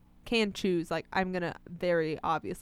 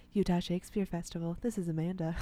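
sexy voice; 2 semitones lower, 5 dB quieter than usual. Shown as a demonstration. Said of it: "like, this sexy voice".

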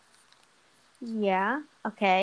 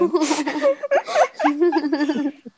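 bright burst of laughter; 7 semitones higher, 5 dB louder than usual. Real, not acted. Laughing at British accent joke.

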